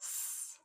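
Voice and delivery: "s" as a drawn-out unvoiced hiss; isolated letter sound. Shown as a demonstration. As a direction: sound out just the s. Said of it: "So the first sound".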